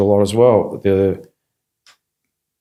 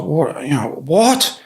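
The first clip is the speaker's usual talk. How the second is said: bewildered; 6 semitones higher, slower than usual. Retold or imitated. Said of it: "And I was just like".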